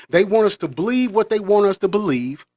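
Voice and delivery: ordinary delivery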